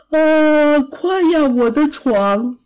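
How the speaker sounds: long drawn-out yawn into words. A narrator's reading. Rabbit hurrying home to bed, yawning. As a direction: say it slowly through a big sleepy yawn. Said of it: "它猛打着呵欠".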